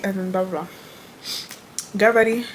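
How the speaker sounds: ordinary talk, nothing marked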